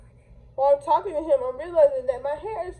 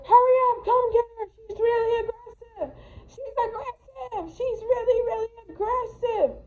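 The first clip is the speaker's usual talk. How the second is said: much higher whiny mock panic; mocking the woman; 10 semitones higher than usual. Woman on the phone to police, high mock whine. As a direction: overdo her dramatic call to police. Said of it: "She's all like".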